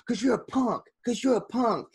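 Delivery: high, lisping Tyson voice; squeaky voice, calling him a punk; Mike Tyson impression. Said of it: "Mike Tyson goes".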